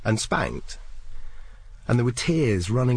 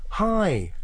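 much higher, cheery hi back; matching bright greeting; 12 semitones higher than usual. Retold or imitated. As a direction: copy her cheerful tone. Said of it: "And I went".

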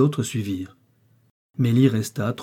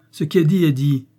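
higher, louder defiant declaration; 5 semitones higher, 6 dB louder than usual. A narrator's reading. Wife defying her husband's reproach, higher and louder. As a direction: stand her ground harshly.

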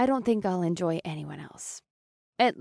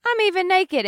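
whining; 11 semitones higher, 5 dB louder than usual. A narrator's reading. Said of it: "Trina whined".

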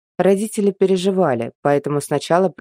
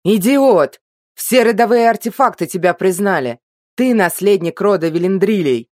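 loud, higher angry shout; 5 semitones higher, 4 dB louder than usual. Acted. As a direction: yell it at the fool. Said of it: "а потом закричал".

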